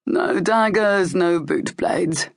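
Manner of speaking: slow, stern captain laying down the rules; a hint of a gruff guard captain. A narrator's reading. Guard captain warning Locke and Cale, slow and stern. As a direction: spell out the rules slowly, unimpressed.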